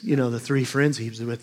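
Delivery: ordinary delivery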